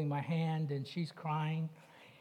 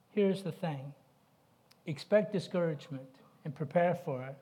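normal talking voice

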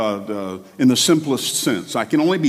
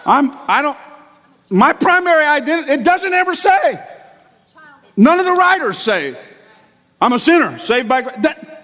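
much higher, louder, impassioned; fervent preaching; 12 semitones higher, 4 dB louder than usual. Real, not acted. Preacher rejecting "sinner saved by grace", high and fervent. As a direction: push it up in a fervent climb.

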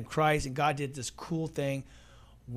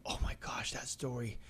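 hushed whisper; a sudden hushed aside; 4 semitones lower, 11 dB quieter than usual. Real, not acted. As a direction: breathe it out, almost silent. Hushed aside before telling story.

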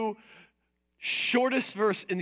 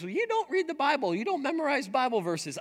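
mock nagging spouse; 7 semitones higher, faster than usual. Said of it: "So when your spouse says".

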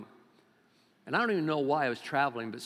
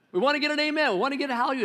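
much higher, louder hammed-up preacher call; mock loud preacher; 11 semitones higher, 8 dB louder, much faster than usual. Pastor working the people up, much higher and louder mock shout. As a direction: crank it up like a preacher chasing an amen. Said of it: "they talk real loud and they yell".

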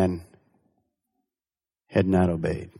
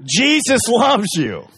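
mock yell; 10 semitones higher, 4 dB louder, slower than usual. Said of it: "stop and yell in a mailbox".

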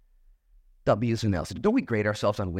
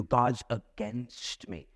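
mock self-pity; 4 dB quieter, much slower than usual. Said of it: "She could process it that way. We often do".

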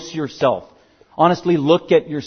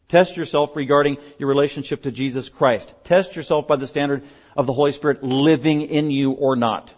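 ordinary talk, nothing marked

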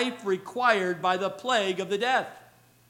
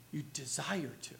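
whisper; 8 semitones lower, 13 dB quieter than usual. Real, not acted. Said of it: "whispered end of point".

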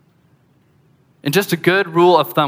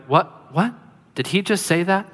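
hushed incredulous question, rising at the end; mock disbelief; 4 semitones higher than usual. Imagined stunned onlookers at tomb.

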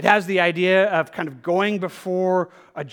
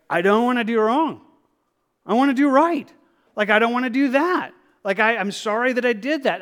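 impassioned plea; 6 semitones higher, 6 dB louder than usual. Acted. Voicing a repentant sinner's plea.

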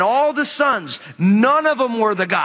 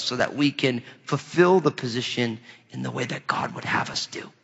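fading to whisper; 8 semitones lower, 6 dB quieter than usual. Real, not acted. Preacher drops voice on application.

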